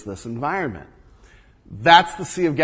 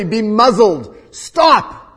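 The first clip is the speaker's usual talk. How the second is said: much higher and louder, barked stop; sharp command; 5 semitones higher, 10 dB louder, much slower than usual. Acted. Jesus muzzles the storm with a loud sharp command. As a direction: snap the order at the wind.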